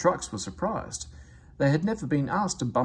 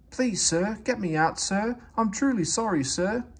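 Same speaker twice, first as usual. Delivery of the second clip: pleading; 8 semitones higher than usual. A narrator's reading. Percy begs Fat Controller.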